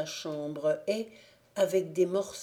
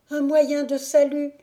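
high, loud urgent cry; fleeing girl pleading; panicked plea; 9 semitones higher, 10 dB louder than usual. Acted. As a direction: call for help in alarm. Zingora begs for escape.

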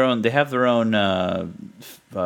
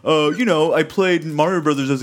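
mock bro voice; 6 semitones higher than usual. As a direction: play a smug bro justifying a dumb tattoo. Mocking a friend's imagined excuse.

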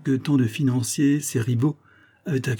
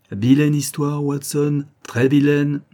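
grave; 5 semitones higher, 3 dB louder, slower than usual. Acted. Holmes juge l'affaire grave.

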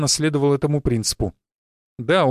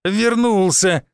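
higher, slow delighted cry; joyful exclamation; 5 semitones higher, much slower than usual. A narrator's reading. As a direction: welcome a loved one home, drawing it out. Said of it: "радостно воскликнула она". (радостно воскликнуть, нараспев)

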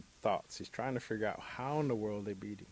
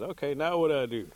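louder, higher question; questioning Bear; 5 semitones higher, 9 dB louder than usual. A narrator's reading. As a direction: call up to your hanging friend. Bear asks for next step.

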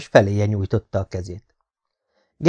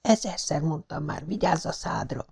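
whispered scolding; 6 semitones higher, 9 dB quieter than usual. A narrator's reading. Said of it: "súgta oda Tara".

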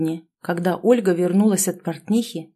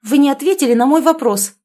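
loud, higher sharp retort; 6 semitones higher, 6 dB louder than usual. A narrator's reading. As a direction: fire it straight back. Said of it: "парировала она".

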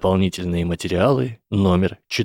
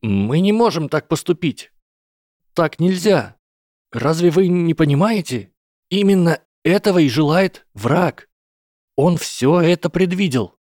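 put-on higher, urgent young hobbit; Frodo's voice; 8 semitones higher, 3 dB louder than usual. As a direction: plead with the council, pitched up. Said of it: "его тихим голосом говорит кто-то другой".

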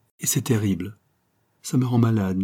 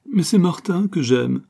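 higher, plaintive protest; 9 semitones higher, 4 dB louder than usual. A narrator's reading. Daughter plaintively protests to her mother, higher. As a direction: plead plaintively. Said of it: "protesta Ruth d'un ton plaintif".

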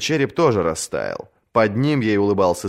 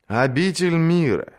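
gentle voice; 8 semitones higher than usual. A narrator's reading. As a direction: welcome her kindly, slowly. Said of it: "ответил ласковый голос".

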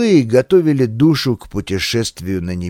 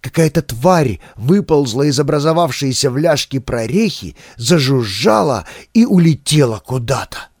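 ordinary delivery, nothing marked

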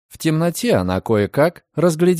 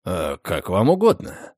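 low, flat, unbothered reply; indifferent; 6 semitones lower than usual. A narrator's reading. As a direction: shrug it off. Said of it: "равнодушно сказал гость". (равнодушно)

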